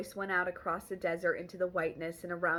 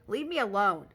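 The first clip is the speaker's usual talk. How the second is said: angry snap; 3 semitones higher, 7 dB louder than usual. Acted. Montag snapping back loudly.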